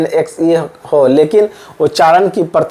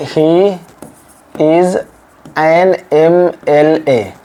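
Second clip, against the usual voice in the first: slow spelled demo; much slower than usual. Shown as a demonstration. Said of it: "ऐसे है".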